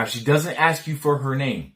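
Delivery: ordinary delivery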